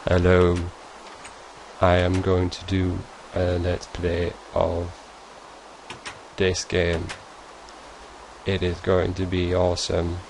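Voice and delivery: slow, soft monotone let's-play intro; dull, lifeless intro. Acted. Dreary YouTuber greeting viewers, slow soft monotone. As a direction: drone it out as if it will bore everyone. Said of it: "And so sitting there saying".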